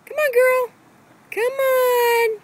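high pet-talk coaxing; coaxing the dog. Real, not acted. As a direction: coax her up high.

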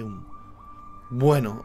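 ordinary talk, nothing marked

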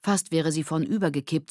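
usual speaking voice

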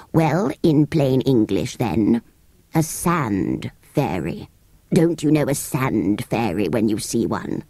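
grumpy sand fairy. Acted. Sand fairy lectures the children, low slow disdain. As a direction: explain with weary disdain.

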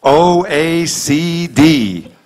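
very slow letter-by-letter reading; reading the eye chart. Retold or imitated. Patient at the eye exam reading letters, very slow. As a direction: read each letter slowly off the chart. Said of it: "read the top line".

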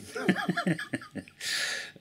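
high airy laugh after the joke; breathy laugh. Real, not acted.

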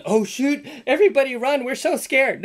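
high, mock-scared; mocking the crowd's fear of a tantrum; sarcastic fake fear. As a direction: pretend to be frightened, dripping sarcasm. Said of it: "I'm not going".

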